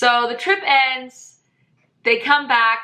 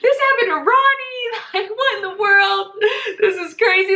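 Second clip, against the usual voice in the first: very high, stunned outcry; can't believe what you just heard; shocked disbelief; 10 semitones higher than usual. Retold or imitated. Narrator reliving hearing the news, shocked and high-pitched. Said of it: "I'm like".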